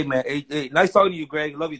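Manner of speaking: usual speaking voice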